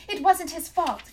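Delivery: high, loud insistence; girl protesting to the engineer. A narrator's reading. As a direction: protest desperately to defend him. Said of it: "Catherine protested".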